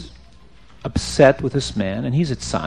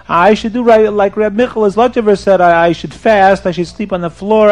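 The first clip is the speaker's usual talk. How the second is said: loud, higher-pitched wailing lament; mock crying; 6 semitones higher, 5 dB louder than usual. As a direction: wail in distress, lightly hammed-up. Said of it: "the man starts to cry".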